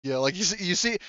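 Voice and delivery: usual speaking voice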